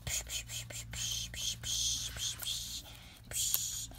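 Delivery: whispered punch sound effects. Pretend.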